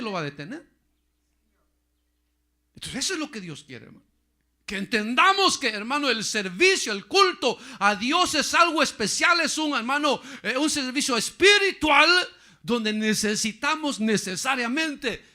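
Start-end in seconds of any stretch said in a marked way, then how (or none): 4.7-15.2 high, loud mocking pulpit shout; preaching at full force; shouting preacher. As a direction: hammer the point home.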